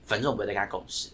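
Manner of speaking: eye-rolling dismissal. Retold or imitated. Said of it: "鱼鸟就翻个白眼说".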